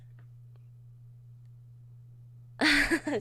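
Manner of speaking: long breathy laughing fit; helpless, drawn-out laughter. Real, not acted. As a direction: crack up at your own acting. Laughing at own acting.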